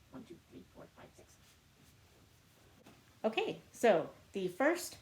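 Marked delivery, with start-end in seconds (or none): 0.1-1.3 counting books in a soft whisper; barely voiced counting along a shelf. Real, not acted. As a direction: whisper the count to yourself as you tick off each book.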